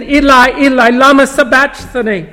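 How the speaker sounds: a preacher's moderate voicing of the loud cry from the cross. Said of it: "cried out with a loud voice".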